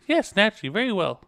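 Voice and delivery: lady voice. Acted. Lady voiced in game dialogue.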